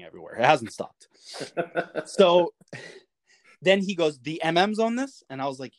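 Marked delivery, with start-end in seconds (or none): 4.2-5.2 higher pitch, rising at the end; incredulous question. Retold or imitated. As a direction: echo it back as a surprised question. Officer questions the sailor's claim.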